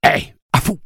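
loud, very high squawk; creature squawk. A narrator's reading. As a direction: screech it like a parrot. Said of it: "de sa voix rauque".

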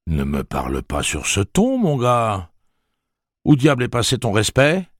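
lightly voiced angry father; stern father. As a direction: scold the boy, building. Father scolds son.

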